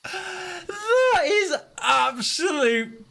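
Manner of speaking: high, loud shocked yelling; shocked shout. Real, not acted. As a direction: yell out in disbelief. Sudden illusory wall shock.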